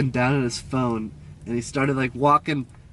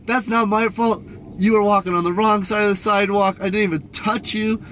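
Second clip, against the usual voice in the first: higher-pitched, indignant comeback; retold indignant protest; 6 semitones higher than usual. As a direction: re-enact arguing back, affronted. Retold argument, protesting blame.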